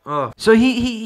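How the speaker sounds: normal talking voice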